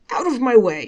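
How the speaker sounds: loud bark at someone in the way; shout. A narrator's reading. Shamus hurrying by, shouting at Selig to move. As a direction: shove them aside with a loud yell. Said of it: "he cried".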